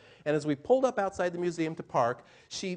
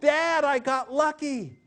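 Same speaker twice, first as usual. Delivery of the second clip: put-on high, slow, loud thrilled child; a delighted daughter showing off her find; elated little girl; 9 semitones higher, 5 dB louder, slower than usual. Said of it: "she was all elated".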